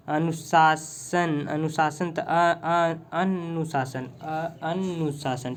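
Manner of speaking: अनुशासन spoken very slowly, syllables stretched; teacher slowly dictates अनुशासन as he writes; slow dictation. Shown as a demonstration. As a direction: draw out अनुशासन while writing it. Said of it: "one minute मैं लिख दूँ".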